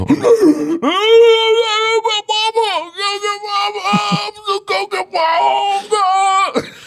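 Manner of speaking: very high, long winded howl; hammed-up squealing cry of pain; mock agonized wail. Imitates himself after bike crash.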